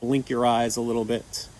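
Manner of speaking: normal talking voice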